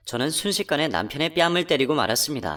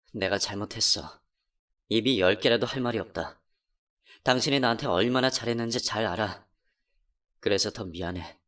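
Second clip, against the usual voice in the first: mild remorseful plea; 5 semitones lower than usual. Acted. Kneeling husband apologizes.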